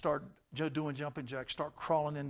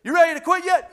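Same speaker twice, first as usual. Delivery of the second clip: high, loud mocking drill-sergeant yell; yelling devil; 17 dB louder than usual. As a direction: bark the taunt to break them. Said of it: "every time he'd say".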